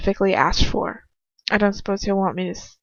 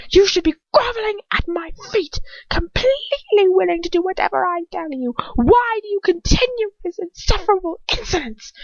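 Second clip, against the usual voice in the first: high, loud, near-screaming fury; furious rant; 11 semitones higher, 6 dB louder than usual. Acted. Lucius rages at his captive, high and screaming. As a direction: rage down at a prisoner who should grovel.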